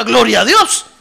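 loud Gloria a Dios with a rise on Dios; praise shout. Shown as a demonstration. Preacher showing the church what to say, loud. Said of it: "es que diga".

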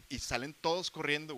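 normal talking voice

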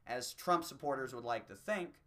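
usual speaking voice

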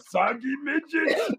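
put-on Arnold accent. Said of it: "the soggy midgets".

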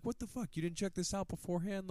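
high, indignant imitation of a telling-off; mock berating. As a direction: play exasperated scolding without raising the volume. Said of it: "berating it".

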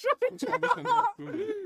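high, loud laughing command; laughing shout. Real, not acted. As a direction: laugh through the order. Laughing while repeating instruction.

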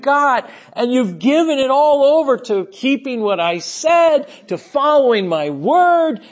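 high-pitched, impassioned preacher's quote; fervent delight. Acted. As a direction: voice the Lord's delight with rising fervour. Voicing the Lord's delight in believers.

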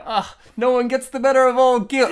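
loud, high boastful Gil. Retold or imitated. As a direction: bluster like Gil. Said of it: "And Gil's all like".

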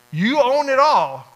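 fervent declaration. Acted. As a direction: proclaim it slowly with everything you have. Preacher's prayer builds to a climax.

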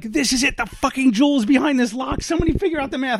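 high-pitched mock frantic ranting; hammed-up stressed rant; frantic exasperation. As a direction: order everyone around in comic desperation. Retold frantic outburst at family.

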